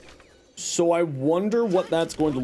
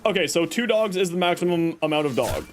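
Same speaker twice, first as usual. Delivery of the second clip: usual speaking voice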